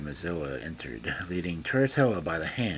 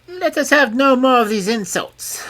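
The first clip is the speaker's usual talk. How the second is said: much higher, louder fairy cry; fairy cries; 8 dB louder than usual. A narrator's reading. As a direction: cut the quarrel short. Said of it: "cried the fairy".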